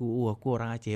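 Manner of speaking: ordinary talk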